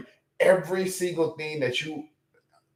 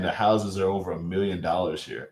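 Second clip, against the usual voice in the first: ordinary talk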